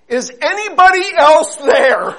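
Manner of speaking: high, loud call for someone else; crying out. Acted. Man ignoring God, shouting for anyone else. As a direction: shout past God for other help. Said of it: "he cried out again".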